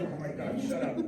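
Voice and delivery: hushed comic aside; exasperated mutter. Retold or imitated. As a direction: mutter it to yourself, hidden from the kids. Teacher hiding, muttering at the kid.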